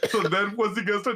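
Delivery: ordinary talk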